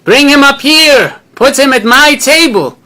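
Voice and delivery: high, loud outraged command; indignant shout. Retold or imitated. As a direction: bellow across the room, demanding. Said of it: "Rabbi Mackenzie shouts out".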